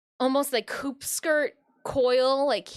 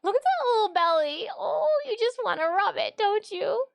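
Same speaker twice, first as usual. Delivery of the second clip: higher, louder cooing over the belly; 7 semitones higher, 6 dB louder than usual. Real, not acted. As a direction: gush over the cat's tummy.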